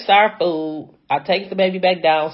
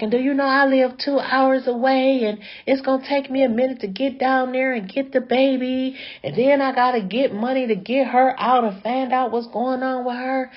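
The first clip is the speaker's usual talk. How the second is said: higher, lightly voiced exasperated sister; a hint of a sister venting on and on; going off; 8 semitones higher than usual. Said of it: "she was just going off".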